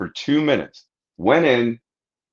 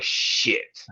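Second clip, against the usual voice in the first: breathed out, no voice; a scared whisper under the breath; whispered shit; 8 dB quieter than usual. Retold or imitated. Man spotting a football team staring, in a whisper.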